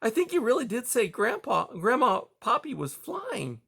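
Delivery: plain reading voice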